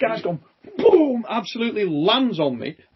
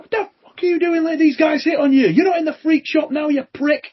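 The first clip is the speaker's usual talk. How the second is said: furious rant; 7 semitones higher, 3 dB louder than usual. Retold or imitated. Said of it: "And he's like".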